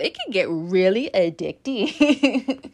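slow, exaggerated animated delivery; super animated. Real, not acted. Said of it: "am I talking like this".

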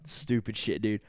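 ordinary talk, nothing marked

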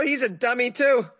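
normal talking voice

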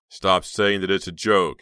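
loud, slow irritated snap; snapping. Acted. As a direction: snap it at her, slowly. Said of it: "he snapped".

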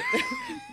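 high shrieking burst of laughter; laughing shriek. Real, not acted. Storyteller cracks up remembering his English, shrieking laugh. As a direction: break into a squealing laugh. Laughing fit while retelling.

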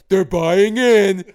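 high, slow, loud mocking fan; that silly voice. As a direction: stretch it out like a smug fan. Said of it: "you use that voice with your wife".

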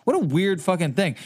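usual speaking voice

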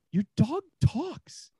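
high, slow, stunned; amazed buyer. Acted. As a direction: let each word land in disbelief.

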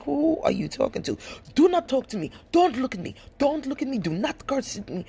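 high-pitched, ranting imitation of an angry man; mock yelling. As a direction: bark each order in a mock tirade. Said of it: "he was yelling and screaming and telling me".

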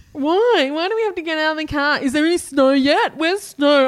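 put-on high, loud, whiny kid's complaints; whinging child. Mother imitating her son whinging about snow in the car. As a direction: whine like a bored kid stuck in the car.

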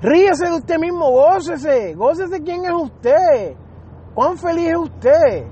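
high, drawn-out preacher's urging; exuberant exhortation. Real, not acted. Preacher urging the congregation to laugh at themselves and rejoice, exuberant exhortation. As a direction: urge them on joyfully, stretching the words high.

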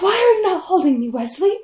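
high, loud, quick plea; exaggerated lovelorn complaint; pleading heroine. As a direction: demand a hug in a mock-needy rush. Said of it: "She's like".

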